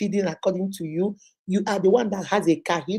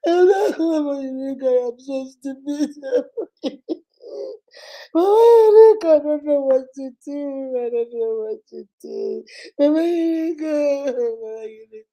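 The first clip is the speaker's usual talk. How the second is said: higher crying protest, fading out; mock crying; 4 semitones higher than usual. Client sobbing to Mama Unique she knows what to do, higher crying. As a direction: sob out the protest, trailing off.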